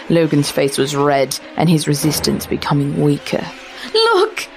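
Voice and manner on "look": sudden high cry of alarm; a young woman crying out as the shadow strangles her fiancé; cried out. Acted. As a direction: cry out to point at the danger. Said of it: "she cried out".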